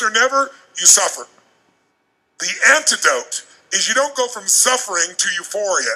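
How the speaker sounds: normal talking voice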